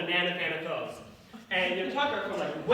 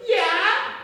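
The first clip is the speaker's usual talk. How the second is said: put-on child's chirpy yes; 11 semitones higher, 7 dB louder than usual. Kid answers, camp story.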